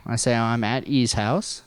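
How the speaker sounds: usual speaking voice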